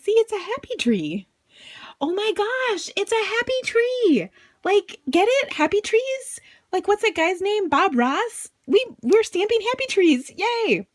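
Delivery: high, gushing squeals of delight; bubbling over with glee; excited. Real, not acted. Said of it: "I get a little excitable sometimes".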